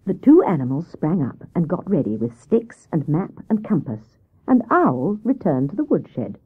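plain reading voice

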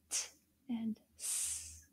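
slow whispered t and s; soft voiceless t and s; t and s sounds. Shown as a demonstration. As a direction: breathe out the bare sounds. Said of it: "the next word is s".

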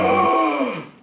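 sharp gasp of delighted surprise; excited gasp. Real, not acted. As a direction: suck in air at a happy discovery. Spots the Tim Tams.

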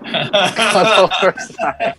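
high, drawn out through laughter; laughing repeat. Real, not acted. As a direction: echo the joke while cracking up. Repeating a joke while laughing.

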